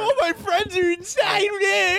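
hammed-up tearful shout at the door; sad frustrated yell. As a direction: wail it like a denied partygoer. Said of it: "screaming in, like, sad frustration".

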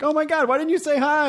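high-pitched excited gush; mock-gushing squeal. Acting out own gushing reaction.

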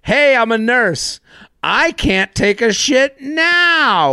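high slow exasperated whine; mock whiny nurse. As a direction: whine like a put-upon nurse.

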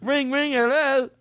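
very high mock Cockney hello; Cockney accent. Copying a co-host's phone bit, high mock Cockney. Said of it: "that Cockney guy".

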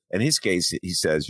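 usual speaking voice

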